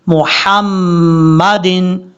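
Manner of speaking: मुहम्मदिन slow, the ह light and thin; correct reading. Shown as a demonstration. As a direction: stretch मुहम्मदिन with a fine ह. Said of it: "इस तरीके से।". (मुहम्मदिन को बारीक ह से पढ़ना)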